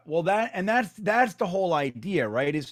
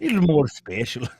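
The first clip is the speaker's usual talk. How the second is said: imitating the ad's Irish-accented voiceover; mock Irish accent; 5 dB louder than usual. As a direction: echo the advert in a mock Irish lilt. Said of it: "talk in that whole accent".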